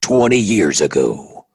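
deep, slow trailer narrator; movie trailer voice. Acted. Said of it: "do the movie trailer voice".

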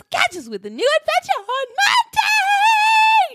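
high, slow, excited announcer call; hype announcer shout. Acted. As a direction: shout the outro like a showman. Podcast outro announcement.

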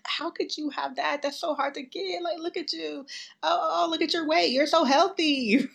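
high-pitched imitation of baffled staff; mock incredulity. Mimicking disbelieving hospital staff.

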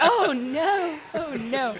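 high, laughing oh no; a laughing, embarrassed realisation. Retold or imitated. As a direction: squeal out oh no with a laugh. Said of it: "I'm like".

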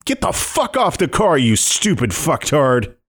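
high furious yell out the window. Acted. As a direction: scream it at the mob. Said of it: "to yell at these goons".

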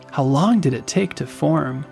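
usual speaking voice

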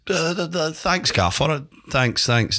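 mock-nervous, high stammering thanks; flustered stammer. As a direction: play a tongue-tied youngster thanking the boss. Flustered young player answering his boss.

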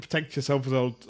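put-on a half-hearted Scottish accent that falls apart; failed Scottish accent. Imitating Victor Locklin's Scottish ring announcement, failing. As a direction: try the Scottish announcer, lose it. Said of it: "In his Scottish accent".

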